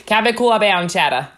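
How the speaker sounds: put-on claw said broad and open in a Long Island accent; hammed-up Long Island accent again. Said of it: "You say claw bound".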